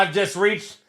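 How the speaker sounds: ordinary talk, nothing marked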